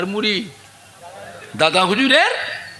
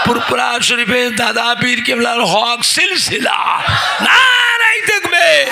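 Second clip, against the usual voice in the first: slow, loud preacher's shout; booming sermon opening; 5 semitones higher, 4 dB louder, slower than usual. Retold or imitated. Scholar greets the villagers at full voice. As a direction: thunder it to the whole crowd. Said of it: "গলা আর বসে না".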